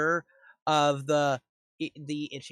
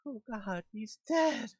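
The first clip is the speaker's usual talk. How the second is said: frightened gnomes; 8 semitones higher than usual. Acted. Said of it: "the deep gnomes are still like".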